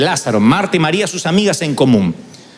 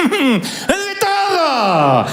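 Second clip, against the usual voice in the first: laughing; 7 semitones higher, much slower than usual. Retold or imitated. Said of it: "Me hice el análisis".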